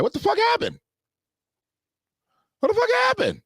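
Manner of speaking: high-pitched, disbelieving squawk; incredulous outburst. Retold or imitated. Viewers looking at each other over the swapped theme song, incredulous. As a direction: squeal it in mock shock, pitch way up. Acts out shocked reaction to song.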